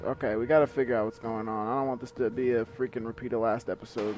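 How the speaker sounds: ordinary delivery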